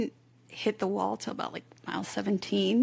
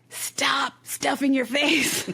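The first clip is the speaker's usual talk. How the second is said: higher, louder and slow, a mock stern order; blunt friend; 5 semitones higher, 4 dB louder, much slower than usual. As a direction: imitate the friend laying down the law bluntly. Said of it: "She's very blunt".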